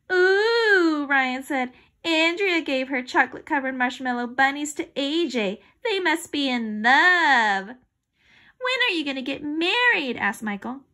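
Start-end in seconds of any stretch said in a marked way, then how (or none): 0.1-1.1 high, loud mocking ooh; teasing ooh. A narrator's reading. Classmate teasing two friends, loud and mocking. Classmate teases about love. 2.0-10.2 raised, singled-out love taunt; teasing. A narrator's reading. Classmates tease about love.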